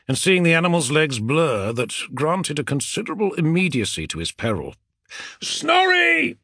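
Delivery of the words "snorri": shout. Acted. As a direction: yell the name over the gallop. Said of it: "he shouted".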